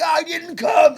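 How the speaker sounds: loud, high mock protest; hammed-up defensive yell; indignant shout. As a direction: holler the denial as the accused man. Repeats the man's protest.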